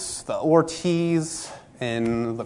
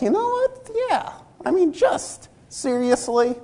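much higher, squeaky mock indignation; imitating a friend's high, outraged reply; 11 semitones higher than usual. Friend voiced in retold story.

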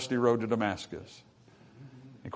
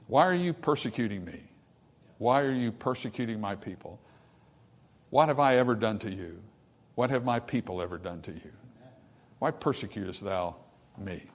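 soft, sorrowful questioning, fading away; sympathetic pleading; 3 semitones lower, 6 dB quieter than usual. Acted. God asking Saul why he persecutes him, softly pleading. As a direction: plead gently, full of mercy. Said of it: "there's sympathy in that".

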